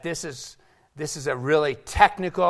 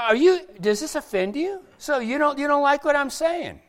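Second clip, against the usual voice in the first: much higher, incredulous challenge; challenging question; 11 semitones higher than usual. Acted. Jesus confronting his grumbling disciples, challenging. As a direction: question them in a raised, high pitch. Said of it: "And he said to them".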